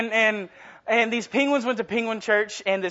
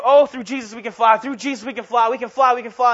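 mock screaming crowd; 4 semitones higher, 6 dB louder than usual. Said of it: "people were just screaming".

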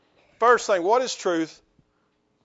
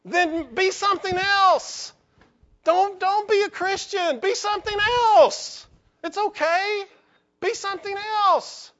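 impassioned plea; 9 semitones higher than usual. Acted. Preacher pleads with congregation.

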